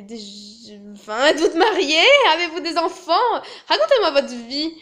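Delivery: questions hautes et fortes, qui trébuchent; interrogatoire maladroit. Acted. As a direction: bafouiller puis insister avec empressement. (interrogatoire surjoué et empressé)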